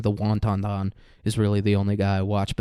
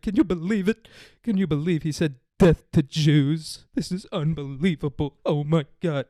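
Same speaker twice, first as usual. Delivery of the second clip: mock outrage; 4 semitones higher than usual. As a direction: parody a whiny scandalized guy. Mocking Portnoy's outrage again.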